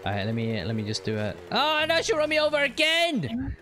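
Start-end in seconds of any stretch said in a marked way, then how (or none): 1.5-3.6 high, raised-voice protest; exclaiming in dismay. Real, not acted. Player run over by partner again, dismayed. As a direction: cry out at the mishap.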